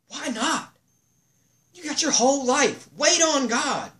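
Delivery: high, strained urging; fervent appeal. Real, not acted. Preacher urging the listeners to wait on God, fervent. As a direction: plead with them to wait.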